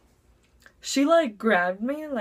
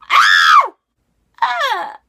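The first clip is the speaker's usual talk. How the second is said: two loud yelping screams, the second much higher; mock scream; 13 semitones higher, 14 dB louder than usual. Realizing she walked in on the wrong teacher, panicked screaming. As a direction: shriek in sudden panic.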